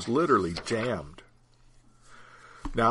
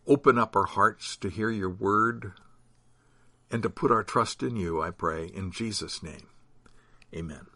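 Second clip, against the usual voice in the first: prayer; 3 semitones lower than usual. Acted. Opening prayer.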